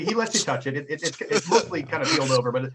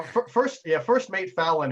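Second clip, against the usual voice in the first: normal talking voice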